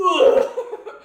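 loud laughing ugh; disgusted groan. Retold or imitated. Friend recalling watching the movie, a loud disgusted ugh. Retold disgust reaction to casting.

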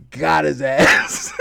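slow, loud punchline through laughter; drawn-out gotcha. Retold or imitated. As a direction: stretch out the taunt, laughing. Slow punchline of prank joke.

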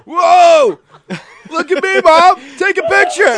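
very high, loud show-off shout; mock clownish yell. Co-host playing manager crashing his bike, hammed-up high yell. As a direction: holler like a kid showing off.

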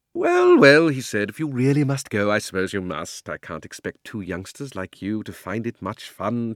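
fake-sweet, ingratiating old man; butter them up, theatrical warmth; oily old uncle. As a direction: uncle flatters the girl to trap her. Uncle Andrew feigns kindness.

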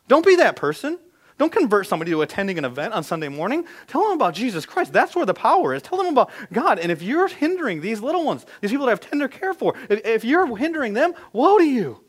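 high, loud pulpit exhortation; preaching at full force. Real, not acted. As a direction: drive the warning home from the pulpit.

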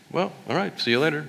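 breezy storybook farewell; lightly voiced cheerful dog.

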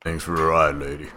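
low rambling folksy hobo; a drifter mumbling his thanks low. Acted. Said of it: "every time that you do the hobo voice".

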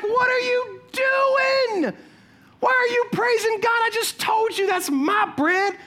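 high, slow, incredulous shout; can't believe what he hears; baffled neighbor. Retold or imitated. Neighbor shouting in disbelief at her praising. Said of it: "he's like".

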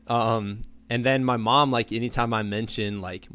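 usual speaking voice

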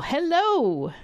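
usual speaking voice

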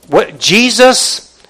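normal talking voice

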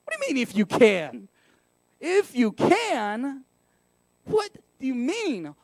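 incredulous, sassy comeback. Acted. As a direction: throw the doubt back with attitude. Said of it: "he has sass. That's how I see it".

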